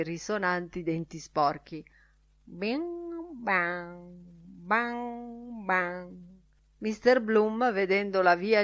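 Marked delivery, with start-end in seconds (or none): 2.4-6.3 sung, resonant string twangs; twanging floss. A narrator's reading. Floss plucked between resonant teeth, twanging. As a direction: imitate a plucked string, letting each note ring. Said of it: "lo fece destramente vibrare".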